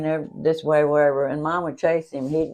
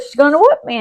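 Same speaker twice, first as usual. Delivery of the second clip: whiny excuse; 10 semitones higher, 8 dB louder, faster than usual. Retold or imitated. Sister explaining.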